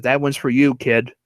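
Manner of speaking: ordinary talk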